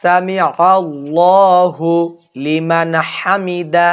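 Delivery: সামি আল্লাহু লিমান হামিদা slow, আল্লাহু stretched, no break before লিমান; correct recitation. Shown as a demonstration. Teacher models the correct prayer phrase for viewers, slow recitation. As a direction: intone it slowly with a held আল্লাহু. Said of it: "সকলে বলবেন".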